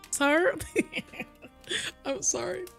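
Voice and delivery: ordinary delivery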